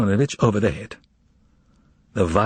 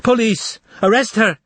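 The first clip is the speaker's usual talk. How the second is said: higher, louder shriek for help; 11 semitones higher, 3 dB louder than usual. Acted. Wife shrieking for police after the umbrella blow, high and loud. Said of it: "shrieked Madame Siempleyarov".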